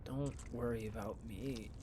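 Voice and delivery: soft, low, drained reassurance; barely muster the energy to reassure; weak and unwell. Acted. Sick student reassures worried friend, weak and unwell.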